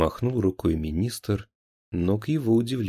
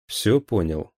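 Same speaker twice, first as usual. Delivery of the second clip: usual speaking voice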